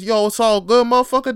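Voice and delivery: high, loud hype-man shout; hyped-up reassurance. Retold or imitated. Friend dapping you up, loud hyped call. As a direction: cheer them on, full volume. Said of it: "When you get this".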